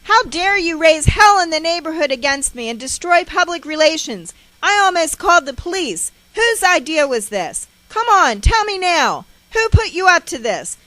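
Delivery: high, loud furious tirade; screamed accusations, pressing for an answer; ranting. A narrator's reading. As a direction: rant and interrogate as an outraged mother. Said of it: "She starts into her verbal ranting at me".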